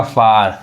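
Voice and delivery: फार said with a long open आ, like "far"; फार with a long आ sound, clearly modelled for students; model pronunciation. Shown as a demonstration. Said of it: "यहाँ पे क्या पढ़ाएगा?".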